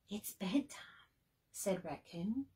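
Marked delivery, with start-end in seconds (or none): 0.1-1.1 hushed and breathy; sleepy whisper. A narrator's reading. Raccoon whispering to Bird that it is bedtime. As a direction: murmur it half asleep.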